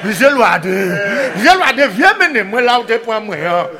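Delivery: the pig crying out again. Acted. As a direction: shout the name through laughter like the pig. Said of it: "Ça qu'a fait".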